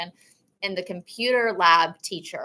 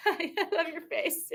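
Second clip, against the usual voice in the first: laughing; 9 semitones higher, 7 dB quieter than usual. Real, not acted. Laughs at a student's face.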